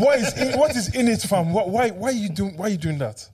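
mock outrage. Scolding a friend back from England, mock outrage. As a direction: demand an explanation in mock disbelief.

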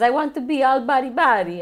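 high, loud mock Italian crooning; lightly hammed-up Italian; fake Italian accent. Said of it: "So he just says".

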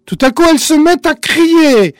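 high, loud storytelling burst; dramatic narration. A narrator's reading. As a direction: announce it with a jolt.

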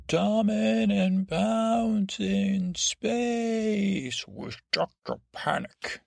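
high, drawn-out title announcement; announcer voice. Pretend. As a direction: announce the show title, very slowly.

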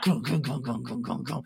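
rhythmic guttural growling; creature growl. Acted. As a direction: grumble like a cold reptile.

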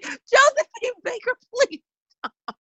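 mock plea. Jokingly begs a celebrity spirit.

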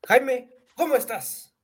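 high, slow, showy greeting; excited. Acted. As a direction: call it out like a host. Hosting greeting, raised.

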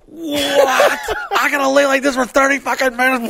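high, laughing disbelief; laughing mock panic, voice up high; laughing outrage. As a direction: cackle through the complaint. Panicked reaction in MRI.

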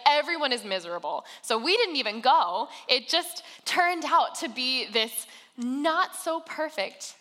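usual speaking voice

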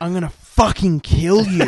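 loud, high mock threat; imitated furious yell. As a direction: imitate an enraged player threatening the ref at full voice. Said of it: "he's on the sideline still yelling out".

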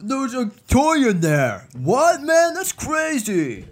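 high, loud mock New York accent. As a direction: overdo a New Yorker voice, all bluster. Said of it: "Me doing a New Yorker accent?".

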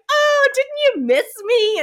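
high, loud singsong tease of a cousin; teasing fairy voice. Retold or imitated. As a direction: sing it up high like a smug fairy mocking his cousin. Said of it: "Sugar Plum Fairy's like".

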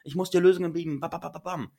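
mock-flustered partner, loud and high; panicky partner. Partner panics at the crying, hammed-up fluster. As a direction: rattle it off like someone who can't bear the tears. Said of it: "sagt sofort so".

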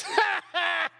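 high mocking ha ha; evil spirit laughing. Spirit mocking the failed disciple with high laughter. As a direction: taunt the disciple with a high cackle. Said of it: "Evil spirit goes".

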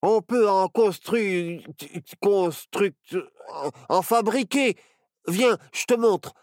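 high, stumbling over the word, excited; eager exclamation. A narrator's reading. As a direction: jump in haltingly, then confidently offer help. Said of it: "s'exclama Barnabé". (exclamation enthousiaste et hésitante)

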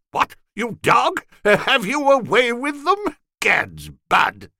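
high, loud tipsy jeering; a sozzled rogue ribbing his friend; drunken lout. A narrator's reading. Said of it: "a low fellow who was fuddled".

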